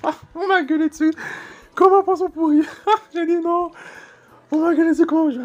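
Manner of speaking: very high, loud squealing through laughter. Real, not acted. Retelling being yelled at, squealing with real laughter. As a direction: shriek it out half laughing, half crying. Squealed repeat of same line.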